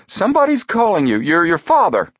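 put-on very high, loud old man's cry; excited old man in a high, loud voice; urgent old man. A narrator's reading. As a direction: shout the news urgently. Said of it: "The old man went on urgently".

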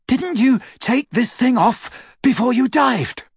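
high-voiced exasperated teen boy; Ron voice. A narrator's reading. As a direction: scold him, still catching breath.

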